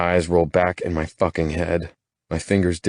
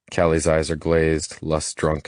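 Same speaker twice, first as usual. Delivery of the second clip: ordinary delivery, nothing marked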